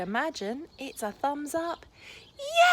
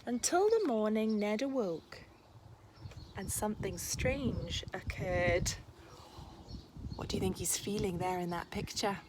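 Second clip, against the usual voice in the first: lively, expressive picture-book read-aloud; reading to children; 4 dB louder than usual. A narrator's reading. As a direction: read it like a bedtime story for a class.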